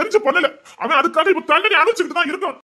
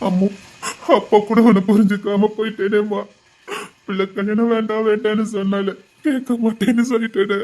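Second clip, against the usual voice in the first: grieving father; 3 semitones lower than usual. Acted.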